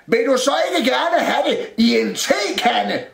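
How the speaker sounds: high, loud cupboard voice; shouted question. A narrator's reading. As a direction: holler the question across a distance. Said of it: "råbte skabet". (råbende spørgsmål)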